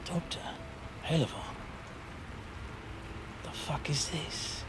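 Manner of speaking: barely voiced, halting disbelief; muttering lord. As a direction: mutter it under your breath with long pauses. Game master voices ailing Casimir.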